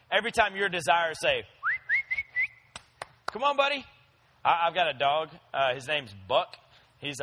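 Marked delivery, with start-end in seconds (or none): 3.3-3.9 coaxing tempter. Pretend. Sin tempting like calling a dog.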